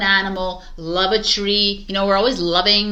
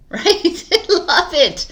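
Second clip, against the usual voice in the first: bright laugh through the words; 10 semitones higher, 4 dB louder, much slower than usual. Real, not acted. Telling viewers to love their ego, laughing. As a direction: laugh as you say it, amused.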